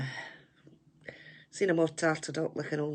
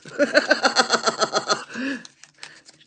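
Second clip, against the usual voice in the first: a high, loud rising cackle; 8 semitones higher, 9 dB louder than usual. Real, not acted. Laughing at a cheeky comment from chat, high cackle. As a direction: let out a high giggling shriek. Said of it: "Do you think that was a naughty wee voice?".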